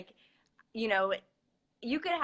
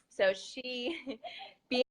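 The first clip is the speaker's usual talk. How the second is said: choking up; 5 semitones higher, much slower than usual. Real, not acted. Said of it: "try not to get emotional".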